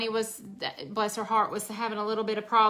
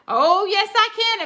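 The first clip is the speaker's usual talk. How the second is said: much higher, louder sure-of-herself reply; confident retort; 10 semitones higher, 6 dB louder than usual. Retold or imitated. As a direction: prove him wrong, chirpy and bold. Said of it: "she said".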